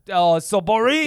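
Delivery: loud mock moan of boredom; quoted complaint. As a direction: groan it out, imitating a bored coworker. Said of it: "somebody at your, at your work said".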